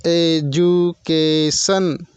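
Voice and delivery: very slow, every syllable of education and democracy spaced out. Shown as a demonstration. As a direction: stretch the word so each syllable is heard. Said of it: "आप इसे उच्चारण करके देख सकते हैं". (हर शब्दांश अलग-अलग धीरे बोलें)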